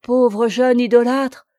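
cried out. A narrator's reading. Atala grasping her lover's hands, an anguished loud cry. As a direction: pity bursting out. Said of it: "s'écria-t-elle".

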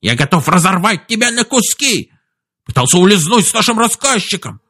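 high-pitched squeaky rage; squeaking. A narrator's reading. Chief threatens to tear Duncan apart, squeaking furiously. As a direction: rant threats in a piping old chief's voice. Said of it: "пропищал он".

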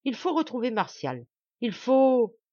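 loud, high declaration building on the last words; aloud, insistent. A narrator's reading. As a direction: declare it out loud, rising in force. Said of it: "Et tout haut, il reprit".

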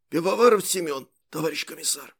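put-on raspy, husky young soldier; hoarse voice. A narrator's reading. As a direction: speak through a hoarse throat. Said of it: "ответил сиплым голосом".

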